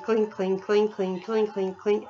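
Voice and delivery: soft clinking chain noise; quiet mechanical clinks; clinking chain imitation. Shown as a demonstration. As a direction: copy the coaster chain clicking. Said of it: "But it's that clink".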